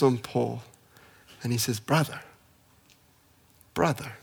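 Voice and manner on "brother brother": soft and hushed, lingering on each word; like welcoming a lost brother home; tender greeting. Retold or imitated. Ananias softly greeting his former persecutor, tenderly.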